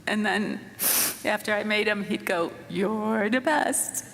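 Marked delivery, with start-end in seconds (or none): 2.7-4.1 slow, drawn-out "You're the best." with the words held long; stretched out. Retold or imitated. Mother showing how her son stretched his praise, slow drawl. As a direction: let the phrase stretch lazily. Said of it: "it would just stretch out".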